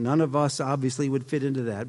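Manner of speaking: ordinary talk, nothing marked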